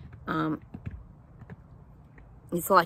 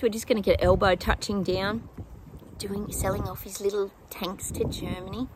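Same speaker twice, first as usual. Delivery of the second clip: ordinary talk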